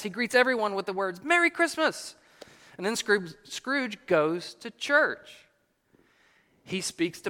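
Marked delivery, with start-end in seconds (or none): 1.2-2.1 high, joyful holiday cry; gleeful, high-pitched exclamation; glad greeting. A narrator's reading. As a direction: call out a merry greeting, pitched way up. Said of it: "He greets Christmas morning with gladness".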